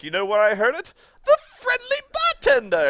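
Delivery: femmy villain building to a shriek; high, loud, hammed-up villain taunt; Turl. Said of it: "being really femmy".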